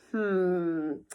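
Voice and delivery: plain reading voice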